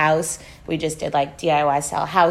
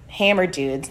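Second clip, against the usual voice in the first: ordinary delivery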